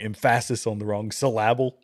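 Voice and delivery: em-FASS-is and suh-LAB-ul for emphasis and syllable; misplaced stress. Retold or imitated. Host recalls a movie line, emphasis and syllable wrongly stressed on second syllables. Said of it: "that line from it".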